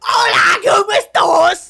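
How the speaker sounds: loud, high mock British Spanish; British accent. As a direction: shout it in a hammy British voice. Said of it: "They're not like".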